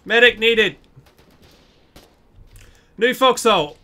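loud urgent callout; shouted call-out. Real, not acted. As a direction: yell it to the team. Calling for help in battle.

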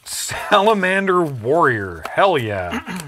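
loud, drawn-out, high hype cry; big, slow, triumphant cheer; excited announcement. Real, not acted. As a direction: relish the name like a champion's entrance. Delighted at finding a favourite token.

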